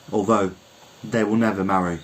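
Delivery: usual speaking voice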